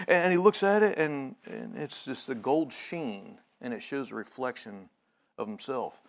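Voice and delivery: ordinary talk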